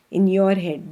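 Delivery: ordinary talk, nothing marked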